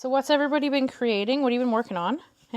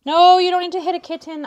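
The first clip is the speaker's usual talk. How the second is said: loud, high scold; scolding the cat; 8 semitones higher, 13 dB louder than usual. Real, not acted. Telling the cat off for hitting a kitten, loud and high.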